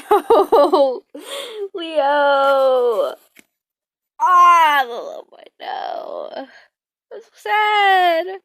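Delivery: real tearful cry over the story; choked up, voice breaking into tears. Real, not acted. Reader crying over a sad chapter, voice breaking. Reader reacts to character death.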